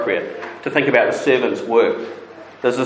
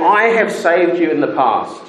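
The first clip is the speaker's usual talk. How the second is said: pleading God; 6 semitones higher than usual. Acted. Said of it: "He's saying".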